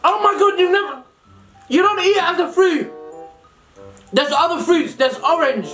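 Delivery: ordinary talk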